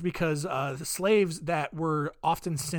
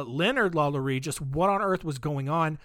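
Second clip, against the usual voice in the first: ordinary talk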